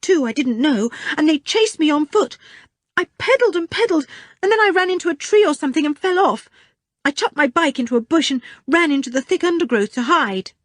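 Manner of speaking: put-on breathless, hurried boy's voice; rushing through a scary story; frightened boy. Richard telling his story.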